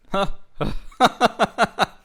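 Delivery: loud, high burst of laughter; long helpless cackle after a forced start; faked laugh turning real. Real, not acted. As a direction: force a big laugh until it becomes real. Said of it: "I tried to really fake it".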